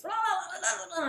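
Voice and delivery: high babbling imitation of a shouting shopkeeper; mimicking Chinese yelling. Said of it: "yelling at me in Chinese".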